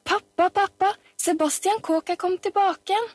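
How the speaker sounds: put-on high, breathless-with-news child's call; high-pitched excited calling; excited children's shout. A narrator's reading. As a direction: burst out with big news for Dad. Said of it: "De kom stormende inn i leiligheten til far sin og ropte".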